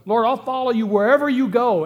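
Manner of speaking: the keen follower calling out his promise; enthusiastic. Retold or imitated. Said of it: "this guy comes along and says".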